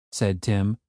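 ordinary delivery

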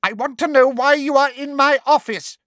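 high, loud demand; angry demand. A narrator's reading. Umbridge demands why Harry is in her office, loud. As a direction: demand it furiously. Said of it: "said Umbridge".

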